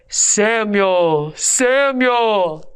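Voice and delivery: lightly voiced God, drawn out. Shown as a demonstration. Teacher demonstrates a mild God voice for the class. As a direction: call his name like God from above, slowly. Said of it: "I need your best God voice".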